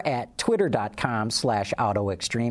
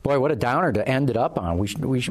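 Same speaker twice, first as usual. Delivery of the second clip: ordinary talk, nothing marked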